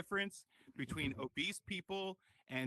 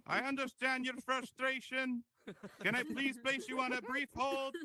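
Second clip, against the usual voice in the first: support agent voice; 9 semitones higher, 3 dB louder than usual. Pretend. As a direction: play a too-polite helpline agent. AI agent calmly stalling.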